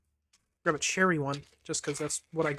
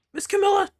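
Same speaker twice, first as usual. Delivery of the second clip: much higher, louder little dog calling out; excited puppy voice; 8 dB louder than usual. Acted. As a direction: yap out her name like an eager puppy. Voicing the dog Missile again.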